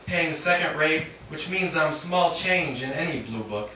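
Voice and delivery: measured, deliberate reading voice; poetry reading. Acted. Poet reading her own poem to an audience, measured reading voice. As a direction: read each line out to the room.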